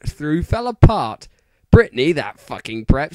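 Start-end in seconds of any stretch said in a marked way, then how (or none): none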